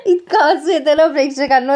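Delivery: laughing while retelling the funny bit; real laugh. Real, not acted. Narrator laughs recounting funny scene.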